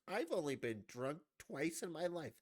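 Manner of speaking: high-pitched mock whine of a radio ranter. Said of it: "he went on some rant".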